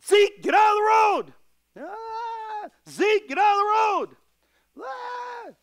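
father yelling at his son in the road, loud and high; yelling at child. Retold or imitated. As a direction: holler at the kid to get off the road. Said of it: "And then I yell".

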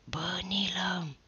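slow hushed call through the dark; whisper. A narrator's reading. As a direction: whisper it slowly so no one else hears. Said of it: "șopti prin întuneric".

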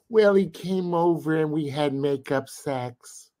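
mock her breezy excuse, slowly; imitating April. April lightly mocked, slowly excusing herself to the bouncer.

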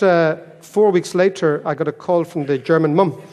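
ordinary talk, nothing marked

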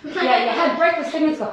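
usual speaking voice

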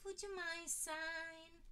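high, very slow sugary voice; sweeter stammer. A narrator's reading. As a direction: sweeten up to the celebrity. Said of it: "stammered with a sweeter voice".

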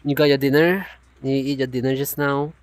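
normal talking voice

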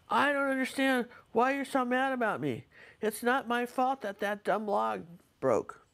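lightly voiced indignant husband pleading innocence; husband's protest. Husband's quoted protest in argument.

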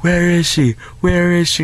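high-pitched imitation of a yelled demand; half-yell it like the intruder; mock yelling. Intruder outside the slammed door, mock yelling. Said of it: "some guy's, like, yelling".

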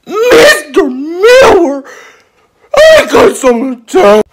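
high, loud, drawn-out pupil voice; whiny pupil. Acted. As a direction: whine slowly for the teacher's attention. Pupil whining for teacher's attention.

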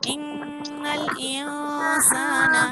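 slow, drawn-out Quranic recitation; teacher reciting for children to repeat. Shown as a demonstration. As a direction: chant it slowly and evenly for the class to follow.

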